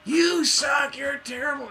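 high put-on yell, dropping away; mock shouting. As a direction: hurl it back like an ousted critic.

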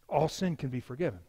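soft, low statement; understated. Real, not acted. Preacher gently offers his congregation forgiveness, understated. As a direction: say it quietly, holding back. Preacher repeats line for emphasis.

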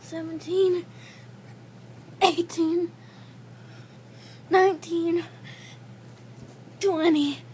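straining, breathless count; doing push ups. Real, not acted. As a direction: count out loud through the strain. Push-ups counted during one minute.

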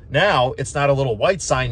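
usual speaking voice

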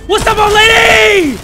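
high, slow, loud mocking shout; taunting shout. Real, not acted. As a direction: yell a taunt at her. Taunting a game enemy.

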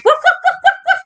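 very high playful woofs; squeaky puppy barks, rising; high yappy woofs. Shown as a demonstration. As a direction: yap like a tiny puppy. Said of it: "let's hear those doggy noises".

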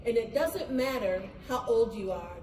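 ordinary delivery

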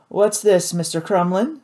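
charming host doing a robbery; mildly voiced smooth pirate captain; suave pirate captain. A narrator's reading. Spearglass questions pirate.

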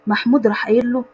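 usual speaking voice

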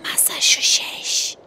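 slow spooky whisper; whispering while hiding. Pretend. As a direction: hush it like a horror game. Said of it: "ben böyle fısıldayarak".

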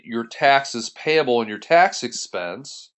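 usual speaking voice

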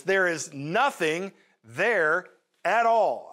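slow and deliberate, every word weighted; "nothing" and "all" pressed down hard; emphatic nothing. Retold or imitated. Preacher voicing the widow's insistence, slow and deliberate. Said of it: "she emphasizes this".

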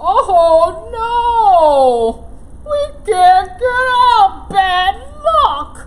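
loud, high singsong lament; critters wailing. Acted. Critters lamenting after the branch crashed. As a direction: wail it in dismay.

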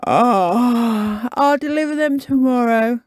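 sleepy Kipper yawning over his invitations. Acted. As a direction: stretch the ah into a big yawn. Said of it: "yawned Kipper".

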